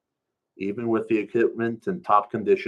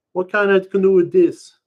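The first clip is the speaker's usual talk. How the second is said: high, loud appalled question; shocked; 11 semitones higher, 10 dB louder than usual. Acted. Shocked question about the orange canoe, high and loud. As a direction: stare at the ugly canoe.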